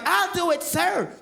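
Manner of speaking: put-on high, keen child voice; an eager boy speaking to the official; eager little kid. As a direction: play a boy volunteering.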